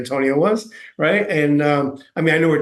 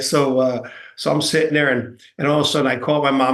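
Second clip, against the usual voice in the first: usual speaking voice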